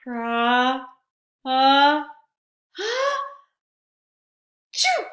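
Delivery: building sneeze ending in a high loud choo; big sneeze. Acted. The bear sneezing from the pepper, building to a loud burst. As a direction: wind up and blast out the sneeze. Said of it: "He blows and he sneezes".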